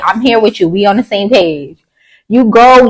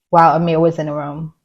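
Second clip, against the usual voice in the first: ordinary delivery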